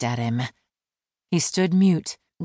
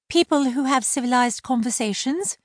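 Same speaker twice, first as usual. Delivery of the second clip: much higher, precise; high-voiced mermaid explaining manners; prim mermaid; 8 semitones higher than usual. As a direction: lecture him politely. Laurel lecturing.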